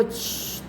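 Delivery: soft, voiceless "shh"; imitated hushing. Abuser signalling the girl to stay silent, imitated hush. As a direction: imitate someone quietly shushing a child. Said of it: "se puso el dedo en la boca y me dijo".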